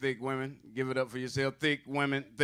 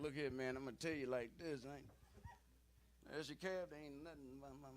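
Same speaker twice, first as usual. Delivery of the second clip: put-on old man mumbling; 15 dB quieter than usual. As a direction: let the words fade into a mumble. Old dude mumbling his language.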